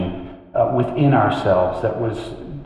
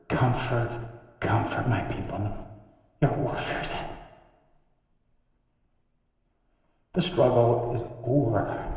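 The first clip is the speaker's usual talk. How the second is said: tender comfort; 9 dB quieter than usual. Acted. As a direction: hush it, pausing between phrases to console. Voices comfort people needed to hear.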